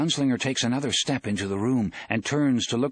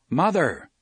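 calling out; 4 semitones higher, 4 dB louder than usual. A narrator's reading. Roland calls for his hidden mother.

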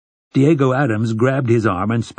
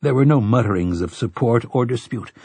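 plain reading voice

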